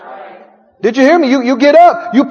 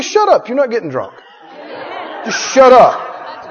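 telling off the flesh; 3 dB quieter than usual. Acted. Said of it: "You say".

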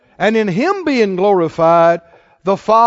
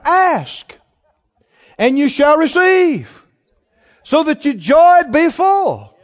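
higher, slow proclaiming; preaching climax; 6 semitones higher, slower than usual. Acted. Preacher urging the church not to do without, proclaiming. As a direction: ring it out as a promise.

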